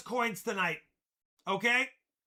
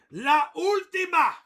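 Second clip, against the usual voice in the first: loud, high announcement in Spanish; Spanish flourish; 5 semitones higher, 7 dB louder than usual. Acted. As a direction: call it out with a Spanish accent. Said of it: "I do have some Spanish in me".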